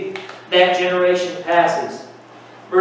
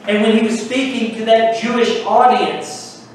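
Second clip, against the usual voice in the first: ordinary talk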